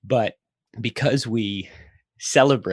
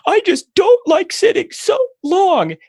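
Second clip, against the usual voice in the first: put-on very high, loud, drawn-out child complaint; hammed-up squealing kid voice; whining four-year-old; 8 dB louder, slower than usual. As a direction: mimic a little kid wailing in protest. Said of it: "And she goes".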